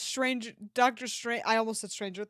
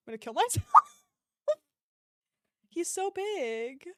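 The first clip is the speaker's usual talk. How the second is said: laughing squeal; 9 semitones higher than usual. Real, not acted.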